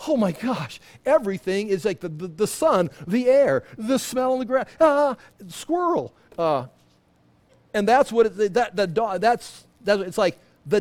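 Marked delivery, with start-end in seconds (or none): 0.1-6.1 rising, thrilled, swept away by every smell; excited dog voice. Retold or imitated. Pastor voicing the dog seeing a squirrel, higher and excited. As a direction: gush like a dog overwhelmed by the world. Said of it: "and he's like".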